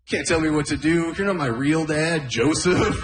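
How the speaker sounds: put-on a defiant kid sassing his stepdad; mock teenage brat; bratty talk-back.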